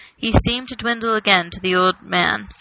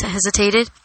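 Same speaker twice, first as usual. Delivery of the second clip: ordinary delivery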